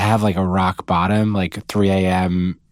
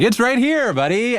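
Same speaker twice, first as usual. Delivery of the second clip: mocking reveal; 13 semitones higher than usual. Shill reveals the trick to him.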